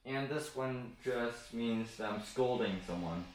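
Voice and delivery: normal talking voice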